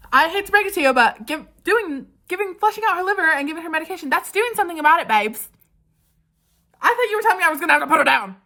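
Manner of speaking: high, loud, rushed indignant rant; exasperated outburst. Retold or imitated. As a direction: push it out high and fast, exasperated at the vet. Acts out her indignant reply to vet.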